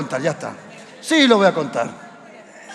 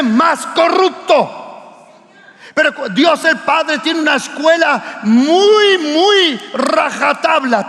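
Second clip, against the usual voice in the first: raised, driving sermon cadence; full-force preaching; 3 semitones higher, slower than usual. Real, not acted. Pastor preaching to his congregation, forceful and raised. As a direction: hammer home faithfulness with money.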